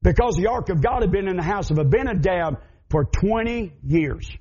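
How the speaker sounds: usual speaking voice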